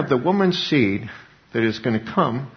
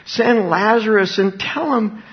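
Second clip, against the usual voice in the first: high, slow, drawn-out plea; acted plea; 7 semitones higher, 4 dB louder than usual. Preacher as the tormented man begging Father Abraham, acted plea. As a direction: plead it out slowly, pitch raised high.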